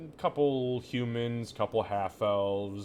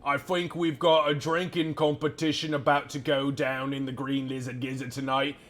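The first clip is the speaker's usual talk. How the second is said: louder, higher announcement to the whole tavern; barkeep announcing to room; 6 semitones higher, 6 dB louder than usual. Acted. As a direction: call out to the crowd like a showman. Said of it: "Ladies and gentlemen".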